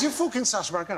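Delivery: mock pub bloke spotting someone famous. Grimsby bloke recognises him in the pub, imitated local voice. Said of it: "a bloke comes up and he goes".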